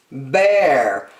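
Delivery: usual speaking voice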